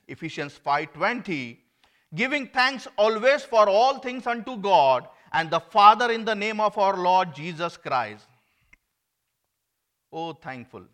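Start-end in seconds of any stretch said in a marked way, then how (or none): 2.1-8.3 much louder and higher preaching of the verse; full-force pulpit proclamation; preaching scripture. Acted. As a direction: thunder the scripture out to the congregation. Loud scripture reading in sermon.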